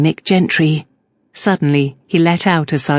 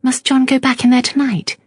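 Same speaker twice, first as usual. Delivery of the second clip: anxious; 8 semitones higher than usual. A narrator's reading. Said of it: "Sophia said anxiously".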